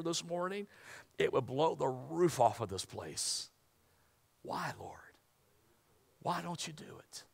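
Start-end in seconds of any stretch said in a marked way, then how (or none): 4.4-7.2 hushed plea. Retold or imitated. Voicing unanswered prayer.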